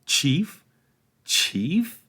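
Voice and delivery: high rising disbelief; incredulous. A narrator's reading. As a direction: repeat it in disbelief. Repeated incredulous rise.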